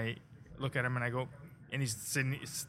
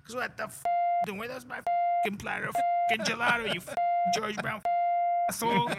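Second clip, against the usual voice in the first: enraged Italian chef, much higher; Italian accent; 10 semitones higher, 5 dB louder than usual. Retold or imitated. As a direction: scream it like a furious Italian chef. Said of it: "with an Italian accent".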